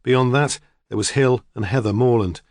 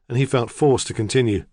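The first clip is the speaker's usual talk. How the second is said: ordinary delivery, nothing marked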